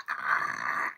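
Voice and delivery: loud open-mouthed "ah" imitation; imitated kid noise. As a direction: imitate kids loudly taking a shot. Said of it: "And they were like".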